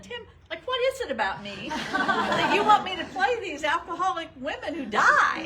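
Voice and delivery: loud, high-pitched comic protest; mock exasperation. Playfully protesting to the director about always getting dying alcoholic roles, mock exasperated.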